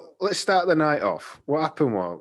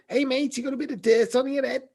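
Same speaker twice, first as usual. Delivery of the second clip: put-on higher, jokey Liverpool accent to a stranger; 12 semitones higher than usual. To a passing stranger in the pub, mock Liverpool accent. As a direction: put on a Scouse voice for the prank. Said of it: "like, Liverpool accent".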